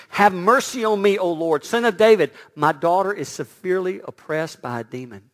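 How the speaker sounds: loud pleading cry for mercy; mother begging loudly for help. A narrator's reading. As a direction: cry out to the Lord, desperate and loud. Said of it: "came out and was crying".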